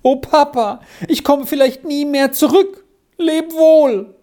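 high, loud theatrical moan.

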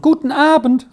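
high, out of breath greeting; a girl panting as she calls out; breathless girl calling. A narrator's reading. Girl arriving out of breath at the farmer's wife. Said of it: "rief sie noch außer Atem".

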